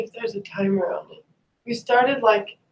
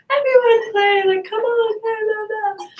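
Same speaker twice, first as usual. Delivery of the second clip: high-pitched sing-out mimicking an eager cheerleader; mock pushy enthusiasm; 4 dB louder than usual. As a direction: parody a peppy rallying voice, much higher.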